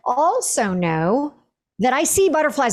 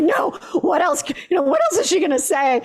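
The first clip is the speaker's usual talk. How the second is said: high-pitched, hurried mock panic; mock petrified; 7 semitones higher than usual. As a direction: act out being petrified of what comes next. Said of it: "where you're petrified".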